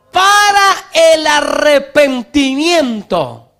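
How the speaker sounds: slow, loud, high preacher reading the verse; preaching shout. Acted. Pastor shouting the verse at his congregation, slow and loud. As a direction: thunder the scripture at the congregation.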